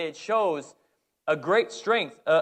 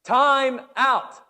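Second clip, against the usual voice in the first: high, loud yell for a timeout; shouting timeout; 9 semitones higher, 6 dB louder than usual. Retold or imitated. Coach screaming for a timeout, high loud yell. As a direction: scream it across the court.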